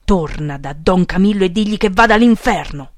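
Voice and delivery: loud, angry shouted order; yelled. A narrator's reading. Section boss sends follower back to the priest, yelled. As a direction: bellow the order at him. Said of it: "urlò".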